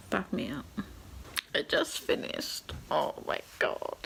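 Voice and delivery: breathless, hushed disbelief; real overwhelmed murmur; stunned whisper. Real, not acted. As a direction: whisper it like the ending just gutted you. Overwhelmed after finishing the book.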